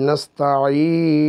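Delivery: slow নাস্তাইনু, vowel lengthened; নাস্তাইনু stretched out; slow demo. Shown as a demonstration. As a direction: draw out নাস্তাইনু slowly. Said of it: "তিন আলিফ পরিমাণ টেনে পড়তে হয়".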